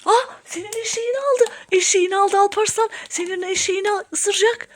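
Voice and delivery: high, urgent pretend panic; rally for a playful rescue; mock alarm. Toy donkey seized by pretend vampire.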